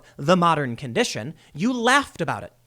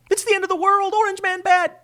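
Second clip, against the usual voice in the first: much higher, mocking panic; mock paranoid liberal; 13 semitones higher than usual. Mocking paranoid viewers' doomsday cry, much higher. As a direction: play up the doomsday hysteria as a joke. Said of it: "they're paranoid and delusional".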